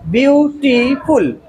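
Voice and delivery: ब्यूटीफुल in three separate beats, byu-tee-ful; measured byu, tee, ful for ब्यूटीफुल; syllable by syllable. Shown as a demonstration. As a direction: break ब्यूटीफुल into its three sounds. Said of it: "three syllable में".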